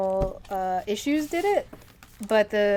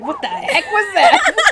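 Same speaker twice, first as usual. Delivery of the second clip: high, loud, drawn-out through laughter; laughing disbelief; 10 semitones higher, 9 dB louder, slower than usual. Real, not acted. Host reacts to co-host's odd noise, laughing loudly. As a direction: crack up while asking, pitched up.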